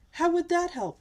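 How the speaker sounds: high, loud queenly protest; crying out. A narrator's reading. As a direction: cry out the question in dismay. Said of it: "cried the Queen".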